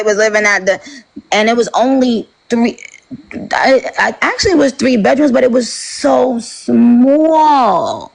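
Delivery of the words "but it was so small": SO SMALL drawn out long and high; emphatic amazement. Real, not acted. Grown woman recalling grandma's crowded house, amazed, stretching "so small". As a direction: stretch "so small" slowly, pitched up in disbelief. Marvelling at tiny childhood house.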